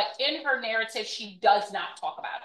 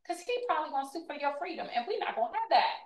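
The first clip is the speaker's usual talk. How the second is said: fast, higher-pitched put-on voice; mock enslaver refusal; 5 semitones higher, 6 dB quieter, much faster than usual. Enslaver explaining why she can't marry him, mock dismissive. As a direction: rattle off the reason, high and quick.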